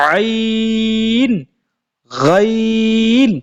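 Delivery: আইন and গাইন drawn out to contrast them; ain then ghain. Shown as a demonstration. Teacher contrasting the two letters for students, slow. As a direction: hold আইন from mid-throat, then গাইন gargled. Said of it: "উচ্চারণ আকাশ পাতাল পার্থক্য".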